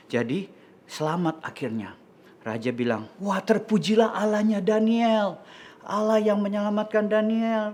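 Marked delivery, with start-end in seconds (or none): 3.2-7.7 high, sustained exclamation of praise; raised, declaiming royal voice; proclaiming king. Acted. King praises Daniel's God.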